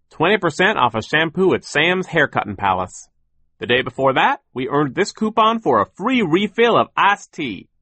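high, loud, animated character voice; optimistic millworker. Acted. Worker explains coupon wages to children, high and loud. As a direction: show off each item in a high, projected voice.

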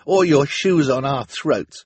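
loud, high, drawn-out retort; defiant. A narrator's reading. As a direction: throw it back at the villain. Boy defies the villainess.

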